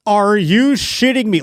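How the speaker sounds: a broadcaster who forgets he's on air; mock shocked announcer. The announcer blurting it out on air, hammed-up shock. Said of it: "everyone's just assuming the person said".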